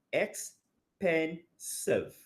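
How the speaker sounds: very slow, drawn-out expensive; slow syllable demo. Shown as a demonstration. Teacher stretching expensive for students to count syllables. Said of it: "about three syllables there".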